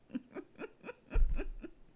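airy high-pitched giggle; giggling at her own slip. Real, not acted. A makeup vlogger giggles at her slip, airy laugh.

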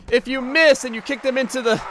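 normal talking voice

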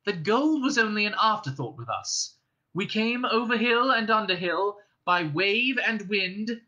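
a small hobbit puffing himself up before a dragon; high, forced boldness; brave hobbit. Acted. Bilbo answers the dragon bravely.

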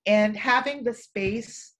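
normal talking voice

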